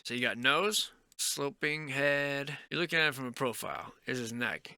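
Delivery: ordinary talk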